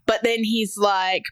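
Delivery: ordinary talk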